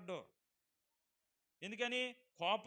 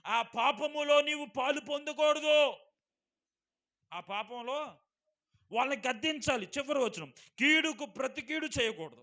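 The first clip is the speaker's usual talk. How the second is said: full force preaching; 7 semitones higher, 14 dB louder than usual. Acted. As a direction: thunder the verse at the congregation. (గట్టిగా నెమ్మదిగా ప్రసంగం)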